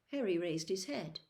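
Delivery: usual speaking voice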